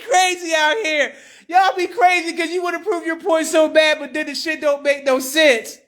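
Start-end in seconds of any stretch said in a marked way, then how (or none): none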